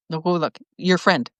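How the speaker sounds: usual speaking voice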